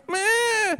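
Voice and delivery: put-on comic high-pitched baby's wail; imitated baby cry. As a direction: wail briefly like a hungry newborn.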